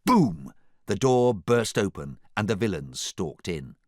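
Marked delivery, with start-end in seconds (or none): 0.0-0.5 loud, punched sound effect; forceful falling thuds; booming impacts. A narrator's reading. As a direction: hit each one like a battering ram. Door bursting open.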